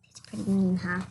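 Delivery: hushed, confiding whisper to the audience; whispered aside. Acted. Wolf whispers an aside to the reading audience. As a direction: lean in and whisper it.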